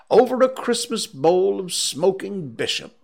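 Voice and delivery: high, gleeful offer; hearty invitation. Acted. Scrooge invites his clerk for a drink, gleeful. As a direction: offer it with jolly relish.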